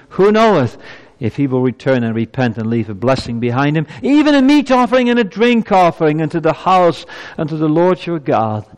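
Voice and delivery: high, loud burst on the verse; ringing pulpit recitation; scripture declamation. Acted. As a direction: proclaim it with soaring fervor. Preacher declaims verse fourteen.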